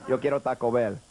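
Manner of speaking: lightly voiced chihuahua mascot line; a hint of the Taco Bell dog. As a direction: deliver it as the ad dog. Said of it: "What would he want the dog to say?".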